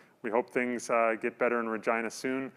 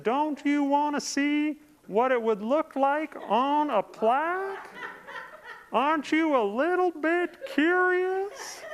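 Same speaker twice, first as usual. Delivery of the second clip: much higher, slow, coaxing, with a laugh; wheedling saleswoman; 12 semitones higher, slower than usual. Retold or imitated. Host mimicking the persistent caller, a much higher coaxing voice. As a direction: tease him into it like a pushy telemarketer. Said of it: "She said".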